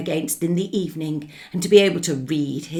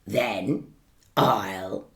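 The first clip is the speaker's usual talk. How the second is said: menacing wolf; 5 semitones lower, 8 dB louder, slower than usual. Acted. Wolf threatens again.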